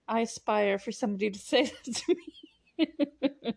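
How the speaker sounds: slow, amused, laughing through the line; half-laughing at her own joke; laughing aside. Real, not acted. Talking to viewers, amused and laughing.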